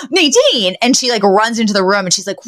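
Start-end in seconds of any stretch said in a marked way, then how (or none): 0.1-0.8 high-pitched mock shock; acted startled recognition, pitched high; shocked double take. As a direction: gasp out the name in sudden realization. Acted double take after a gasp.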